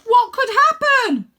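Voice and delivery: very high, loud slow alarmed cry; old man shouting in fear; worried shout. Acted. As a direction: Grandad twisting round to shout at Rose.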